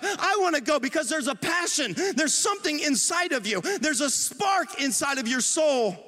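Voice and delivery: high-pitched, driving sermon delivery; urgent pulpit encouragement; impassioned preaching. Acted. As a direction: preach it with rising fervour, pitched well above normal talk.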